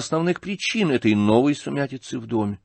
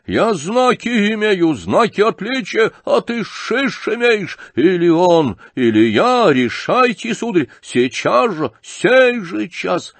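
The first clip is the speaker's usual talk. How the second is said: high, loud, drawn-out rage; frenzied shouting; 9 semitones higher, 5 dB louder, slower than usual. A narrator's reading. General demanding a decision from Птицын, frenzied shout. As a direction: hurl each phrase out, pitch raised. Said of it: "крикнул он опять в выступлении".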